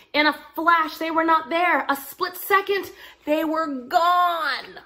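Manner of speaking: put-on narrating the kids vanishing in time; magical effect. A narrator's reading. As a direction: stretch it out like a spell.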